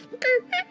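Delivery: very high squeaks; imitated ratchet squeak. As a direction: squeal like a rope pulley lowering. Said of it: "the squeaking of a ratchet".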